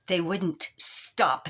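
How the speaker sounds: ordinary delivery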